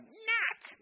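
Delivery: high, loud mock toddler squeal of knack. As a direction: squeal it like a two-year-old grabbing for food. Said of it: "It comes out like".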